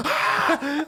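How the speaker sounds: high laughing mock shriek; startled scream. Seeing his friend's swollen face, a mock shriek. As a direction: yelp at the sight.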